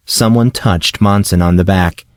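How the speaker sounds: plain reading voice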